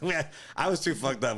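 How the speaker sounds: ordinary delivery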